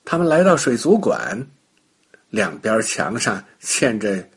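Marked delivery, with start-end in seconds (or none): none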